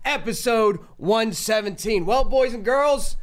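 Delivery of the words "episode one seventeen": loud, drawn-out broadcast opener; booming show intro over music; hype announcer intro. Acted. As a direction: call it like a big-game announcer.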